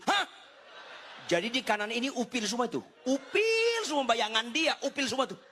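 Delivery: high, hammed-up horrified outcry; mock disbelief. Husband appalled by wife's germ fear, mock disbelief. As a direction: act aghast, voice leaping way up.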